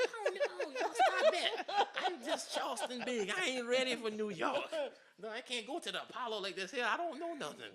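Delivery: high, loud comic pleading; mock protest. Performer begging off being sent to New York, comic protest. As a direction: protest loudly, pitch jumped way up. Acting out his alarmed protest.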